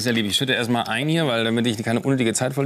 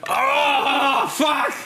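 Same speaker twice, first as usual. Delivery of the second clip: pained shout; 12 semitones higher than usual. Real, not acted. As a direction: yell out from a stinging burn. Burning eyes after tequila stunt.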